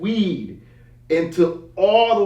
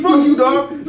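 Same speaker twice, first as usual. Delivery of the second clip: high, loud mock outrage; indignant retort; 7 semitones higher, 5 dB louder, slower than usual. Buyer cursing the seller who slept it off, loudly indignant. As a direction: snap back louder and higher.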